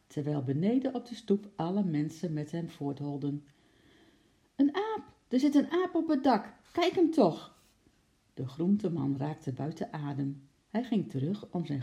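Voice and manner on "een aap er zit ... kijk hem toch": high, loud crowd shout; excited calling out. A narrator's reading. People spotting an ape on the roof, excited high shout. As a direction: call it out to everyone in the street.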